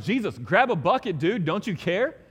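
urgent yelling to wake him; retold panicked fishermen; panicked plea. Panicked disciples waking Jesus.

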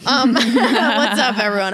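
laughing. Real, not acted. Podcast intro through laughter.

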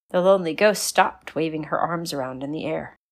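plain reading voice

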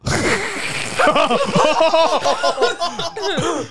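very high cartoon laugh; SpongeBob laugh. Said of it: "I can SpongeBob laugh".

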